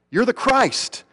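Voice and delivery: "You're the Christ" pitched high, emphatic; powerful declaration. Retold or imitated. Peter confessing to Jesus, "You're the Christ" high and emphatic. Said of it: "so succinctly but so powerfully".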